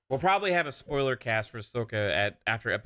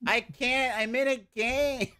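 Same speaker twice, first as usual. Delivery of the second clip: mock high voice; 13 semitones higher, slower than usual. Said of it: "She's like".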